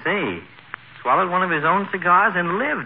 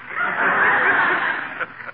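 high breathy laugh at his own joke; doctor chuckling at his quip; doctor's chuckle. Acted. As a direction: laugh at your own gag.

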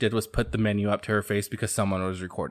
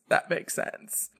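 slow stunned whisper; 6 semitones higher, 8 dB quieter, slower than usual. Retold or imitated. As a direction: whisper "That makes sense" slowly and higher, drawn out. His stunned reaction repeated.

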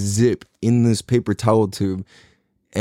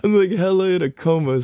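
laughing as he says it, higher; amused and a little higher, laughing at himself; 8 semitones higher than usual. Real, not acted. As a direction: chuckle through the punchline. Laughing at his own story.

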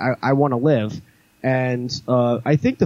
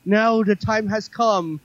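ominous villain; 8 semitones higher, slower than usual. Retold or imitated. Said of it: "she's just like".

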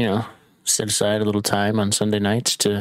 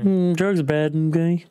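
mocking echo; 7 semitones higher than usual.

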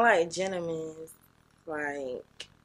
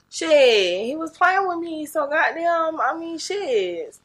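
high, loud self-justifying; loud defensive excuse, stretched at the end; 9 semitones higher, 10 dB louder, slower than usual. Real, not acted. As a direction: protest your excuse loudly, pitched up. Justifies herself when pressed for story.

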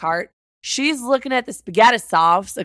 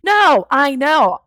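slow, louder exasperated "I know"; exasperated shout; 4 semitones higher, 5 dB louder, much slower than usual. Real, not acted. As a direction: lose patience with her, half laughing. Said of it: "for the love of all things holy".

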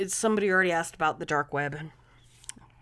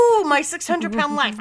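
excited; 7 semitones higher, 9 dB louder than usual. Real, not acted. Echoing a favourite show excitedly.

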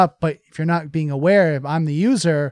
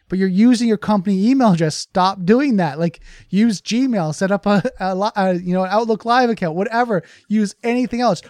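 exasperated scolding; 5 semitones higher, 4 dB louder than usual. Retold or imitated. IT scolding repeat offender.

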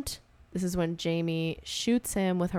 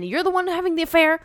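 high, loud accusing put-on voice; mock accusation; 10 semitones higher, 4 dB louder than usual. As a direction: throw the accusation back at him. Acted couple quarrel.

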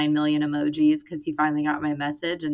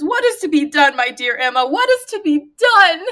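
higher, louder hammed-up lament, laughing at the end; dramatic Mister Weston; 9 semitones higher, 8 dB louder than usual. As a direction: overplay the hand-wringing host. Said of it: "It's so dramatic".

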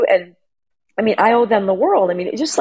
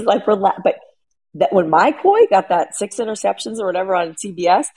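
usual speaking voice

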